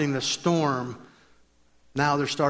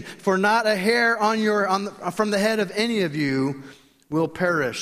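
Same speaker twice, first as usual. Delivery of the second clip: scripture reading; 8 semitones higher, 4 dB louder than usual. Acted. Reads scripture with raised voice.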